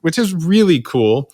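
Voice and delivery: really mean it's cool; excited praise. Real, not acted. Enthused aside to guest.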